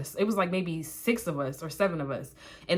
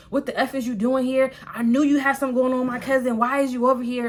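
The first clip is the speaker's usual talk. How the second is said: higher, louder jealous confrontation; march up demanding answers; retold angry interrogation; accusing; 5 semitones higher, 3 dB louder than usual.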